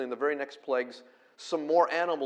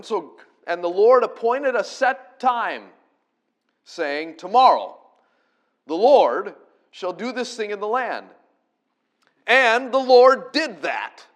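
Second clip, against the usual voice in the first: higher and louder, slow fervent sermon climax; a revival preacher's peak; full-force preaching; 7 semitones higher, 12 dB louder than usual. Acted. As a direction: preach it hard to the congregation.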